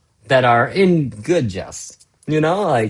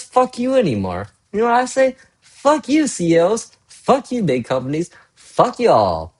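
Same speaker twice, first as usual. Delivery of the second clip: defiant outburst; 8 semitones higher than usual. Real, not acted. Defiant rant at CEOs.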